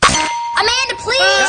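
high, drawn-out "Amanda, please"; mocking high call. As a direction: sing it out in mock exasperation. Quiz catchphrase called out.

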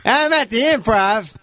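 put-on high, lazy delivery; Tracy Morgan. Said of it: "heard a Tracy Morgan impression".

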